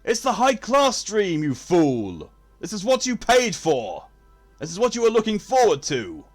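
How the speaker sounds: high, loud posh indignation; hammed-up aristocratic outburst; haughty mock outrage.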